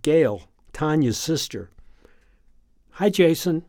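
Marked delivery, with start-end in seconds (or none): 2.9-3.6 brightly. Acted. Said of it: "she says brightly".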